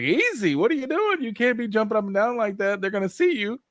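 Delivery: high, urgent warning to the screen; talking to the television. Real, not acted. Viewer urges a jumping rebel on screen to stop. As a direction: scold a careless hero on the show. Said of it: "I was like".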